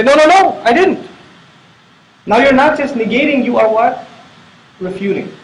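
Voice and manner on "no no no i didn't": higher, louder "No, no, no, I didn't"; insistent denial. Shown as a demonstration. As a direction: protest like you've been wrongly accused. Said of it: "And you say".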